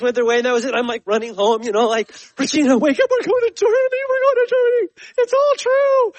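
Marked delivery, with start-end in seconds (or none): 2.4-6.1 much higher, loud, overjoyed calling out; excited shouting. Retold or imitated. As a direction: wake your wife with thrilled shouting news. Running home to wake wife.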